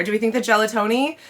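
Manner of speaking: ordinary talk, nothing marked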